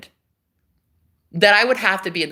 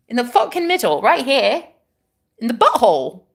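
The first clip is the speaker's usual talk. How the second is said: animated put-on British accent, higher pitched; 5 semitones higher, 3 dB louder than usual. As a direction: explain excitedly in a mock British accent. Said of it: "when you do the British accent".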